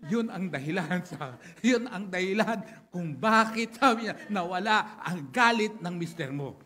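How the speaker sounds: loud, ringing pulpit delivery, words driven home; rally the congregation, punch each point; preaching at full force. Acted.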